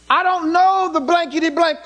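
high, hammed-up swearing denial; mock cursing denial. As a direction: bluster the denial like he's swearing mid-sentence. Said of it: "He didn't even have the words all out".

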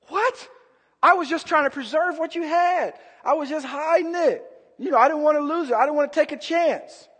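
high-pitched, startled self-defence; protesting servant. Acted. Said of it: "The one with one hid it".

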